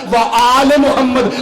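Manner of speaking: slow, with आल drawn out long; लमकाया कर: stretch "आल" in व आल Muhammad. Shown as a demonstration. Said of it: "लमकाया कर".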